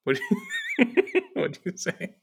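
breaking into laughter mid-question; laughing question. Real, not acted. Co-host laughing through a question to Robbie. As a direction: giggle through the words, way up high.

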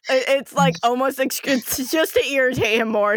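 ordinary talk

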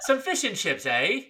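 high, soft attempt at English that slides Australian; badly faked English accent with an Aussie lilt; mock English accent gone Australian; Australian-sounding English accent. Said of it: "both doing an Australian accent here".

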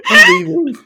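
high, loud mock exit; mock huff. Real, not acted. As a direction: pretend to storm out. Mock storming off.

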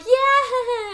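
high, loud whooped yeah; toast cheer. Retold or imitated. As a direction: cheer along after a toast. Acting out cheer after a toast.

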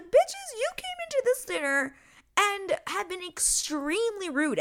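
high, shrill-pitched mock scolding; sassy mock tirade. Host telling off rude guests, high mock scolding. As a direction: squeal it out like telling them off. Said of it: "I'm like".

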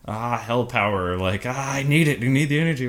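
high, loud manic villain; crazed villain. Retold or imitated. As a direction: rant it like a mad scientist. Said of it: "Other ones are like".

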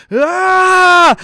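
long, loud, high "aah" scream; imitated battle scream. Retelling the hero firing the machine gun, imitated battle scream. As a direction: hold a full-out action-hero yell.

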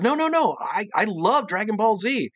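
high-pitched, excited protest; imitated fan insistence. Cartoonist insisting she is a fan.

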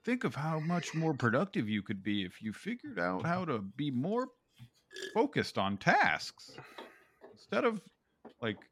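mocking a preachy workplace presenter; mock HR video voice. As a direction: sneer it like a preachy HR training video. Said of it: "H R bullshit where it's like".

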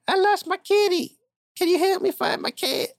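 a desperate client pleading; frantic woman. Acted. Woman client begs the detective for help, high and squeaky.

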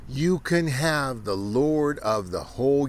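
high, loud sermon thundering against the enemy; fiery preaching. Acted. Preacher warning the sinful against their enemies, loud fiery preaching. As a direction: thunder it from the pulpit.

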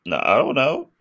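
high-pitched, unsure reply; helpless uncertainty. Retold or imitated. Alpha answering upset Zordon about morphing, helplessly unsure. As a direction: lift the voice into a clueless little protest.

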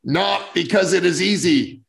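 put-on slow presidential speech in a Massachusetts accent; Kennedy's Massachusetts accent. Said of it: "forgive me for butchering his Massachusetts accent".

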